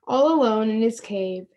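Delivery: ordinary delivery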